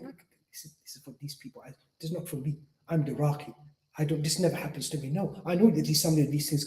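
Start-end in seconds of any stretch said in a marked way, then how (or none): 0.0-5.1 hushed inner voice. Retold or imitated. Arrogant inner voice, imagined thought.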